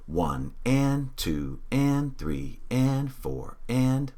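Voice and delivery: slow count, pitch lifting on each and; voice rising on the ands. Shown as a demonstration. Teacher counting the beat with the students, rising on each and. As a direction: count slowly and let your voice climb on every and. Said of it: "I'm even making my voice go up on the ands".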